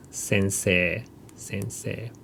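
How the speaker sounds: "sensei" with a drawn-out eh and no i; long e ending. Shown as a demonstration. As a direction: softly model "sensei" ending on a held e. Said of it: "Just long a sound".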